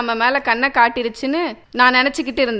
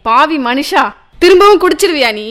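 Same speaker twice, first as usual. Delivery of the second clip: angry wife; 3 semitones higher, 5 dB louder than usual. Acted. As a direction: scold him hard for drinking again. Wife yelling at drinking husband. (கணவனை கோபமாக திட்டும் மனைவி)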